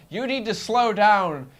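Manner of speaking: slow, high, loud mock order; yelled warning. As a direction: call it out slowly at a reckless pilot. Acts controller yelling at pilot.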